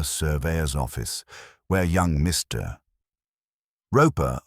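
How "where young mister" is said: usual speaking voice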